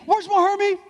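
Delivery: high, defiant declaration; like you mean it. Shown as a demonstration. Coaching kids to mean the slogan, defiant and high. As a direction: belt it out, fully convinced. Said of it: "Say it like you mean it".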